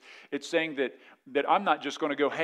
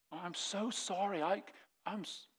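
very soft, higher mock contrition; meek apology; 4 semitones higher, 12 dB quieter than usual. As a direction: play the humble spouse apologizing meekly. Acted humble spouse apologizing.

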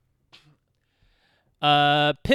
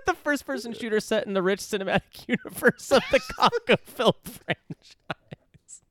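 laughing; 4 semitones higher than usual. Real, not acted. Reading an absurd game description.

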